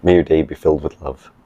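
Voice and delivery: ordinary talk, nothing marked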